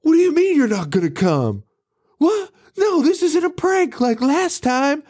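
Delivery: high, exasperated phone plea; hammed-up panicked caller; mock indignant. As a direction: pitch it way up in disbelief. Voicing Radish on the phone.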